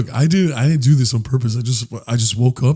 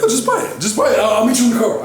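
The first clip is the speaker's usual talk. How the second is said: high-pitched dodge; 10 semitones higher, faster than usual. Acted. Fleeing the bill.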